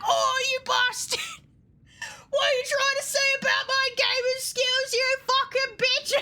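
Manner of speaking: high, indignant mock rant; laughing, high-pitched imitation of an outburst; retold telling-off. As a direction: re-enact having a full-on go at someone. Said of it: "I just had a full-on go at you".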